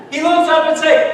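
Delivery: ordinary talk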